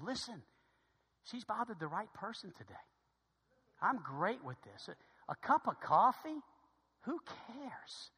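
soft retold comforting; gentle reassurance. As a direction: wave the worry away softly, unhurried. Stranger reassures the apologetic father.

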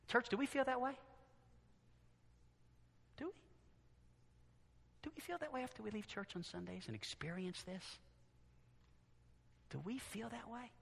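very soft, searching appeal; hushed, with long pauses; hushed questioning. Real, not acted. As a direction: drop to near silence and ask the congregation.

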